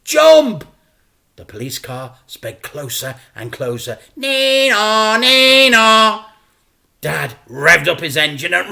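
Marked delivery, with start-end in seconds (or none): 0.0-0.6 high, loud urgent order; shout. Acted. Dad urges his son to jump, a loud high shout. 4.2-6.2 high, loud nee-naw siren; police siren. Acted. The narrator imitates the police car closing in, a loud siren call.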